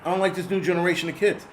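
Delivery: ordinary talk, nothing marked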